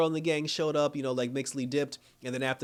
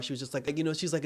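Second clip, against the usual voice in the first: ordinary delivery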